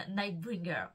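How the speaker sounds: name said with a proud English accent; a touch of English accent, self-mocking. Shown as a demonstration. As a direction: show off your best English accent on the name. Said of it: "On salue mon accent anglais irréprochable".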